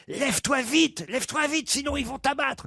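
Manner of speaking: high-pitched pig urging a friend on; acted frantic warning; urgent pleading. Cochon presse le cheval malade.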